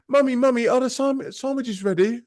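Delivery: put-on high child's voice; child calling mummy. Kid running in from the pool asking mum, high child voice. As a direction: pipe up like an eager little kid. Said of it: "she came in".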